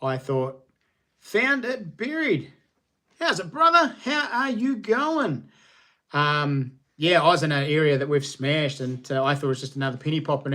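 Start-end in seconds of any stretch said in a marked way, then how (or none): 1.2-5.5 high, slow put-on voice reading a viewer's note; sing-song reading of a friend's greeting; read-out chat message. Streamer reads a viewer's chat greeting, high and slow.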